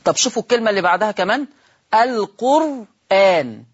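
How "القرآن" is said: القرآن said slowly with the hamza kept, al-qur'ān; without transfer. Shown as a demonstration. Teacher showing that القرآن keeps its hamza. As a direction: draw out القرآن, hamza clearly pronounced. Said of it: "قلت القرآن".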